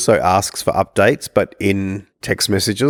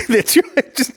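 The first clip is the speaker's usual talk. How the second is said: laughing through pain. Real, not acted. Laughs after being hurt.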